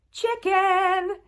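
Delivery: loud, high, drawn-out final syllable; playful reveal. Pretend. Quilter showing off a favorite-food fabric, playfully drawn-out cheer. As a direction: announce it big and stretch the ending.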